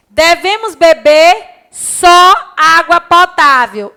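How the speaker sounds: slow, loud, deliberate classroom reading; slow, loud dictation reading. Shown as a demonstration. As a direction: read each word out slowly for dictation. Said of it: "onde teria o acento?".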